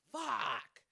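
sudden loud outburst, then owning it; loud curse. Real, not acted. Vlogger blurts a curse to her viewers, loud.

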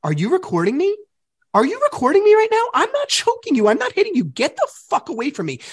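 high-pitched re-enacted angry protest; indignant outburst. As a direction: replay the row, voice jumping way up. Re-enacting his angry argument.

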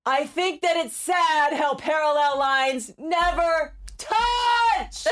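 drawn-out, high, loud shout; full-voiced yell held long for a game. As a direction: shout it slowly, stretching the last words. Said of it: "she's yelling".

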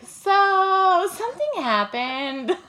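high, loud, then slow awkward admission; mock sheepish confession. Mother telling her mother-in-law bad news, mock sheepish. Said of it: "and be like".

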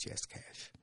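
hushed whisper, barely voiced; furtive whispered yes. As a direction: whisper it like a secret deal. Secret cash bribe offer.

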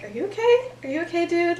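worried friend. Retold or imitated. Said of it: "Sirius is, like, worried".

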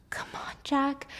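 soft coaxing whisper. Acted. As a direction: pull him in, whispering. Alice coaxes in a hush.

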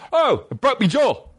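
high mock cockney, pained. Mimicking the injured guy suddenly talking like a cockney, high mock accent. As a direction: whine it in a put-on cockney accent. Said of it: "Immediate fucking cockney".

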